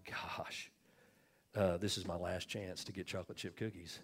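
put-on soft, hushed inner thought of a little boy; muttering to himself. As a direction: think it aloud under the breath. Boy musing to himself in story.

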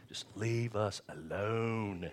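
slow, drawn-out mock plea from a grown daughter; a hint of a fed-up daughter, dragged out slowly; imitating his daughter. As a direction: lightly voice the annoyed daughter pleading to be left alone. Father voicing his grown daughter.

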